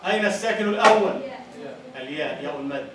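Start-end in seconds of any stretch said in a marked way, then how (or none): 0.1-1.2 الأول stressed loudly as أوّل with doubled waw; louder repeat. Shown as a demonstration. Teacher repeats the question louder for the class.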